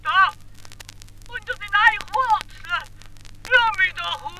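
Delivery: trapped spirit answering Hans; Geisterstimme. A narrator's reading. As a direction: answer meekly from under the roots. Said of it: "die Stimme geht zur Antwort".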